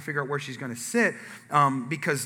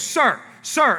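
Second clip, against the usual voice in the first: high, louder call for his attention; acted insistent, pitched-up challenge; confronting wife; 9 semitones higher, 5 dB louder than usual. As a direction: imitate a wife calling out a stranger.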